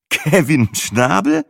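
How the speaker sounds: langsam, höher, fragend ansteigend Kevin Schnabel; ungläubig. A narrator's reading. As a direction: Kevin Schnabel ungläubig in die Länge ziehen. Matze disbelieving repeat. (Matze wiederholt den Namen Kevin Schnabel ungläubig)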